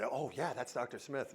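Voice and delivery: a snide little whisper-aside; hushed gossip. Pretend. Hushed gossip about the doctor.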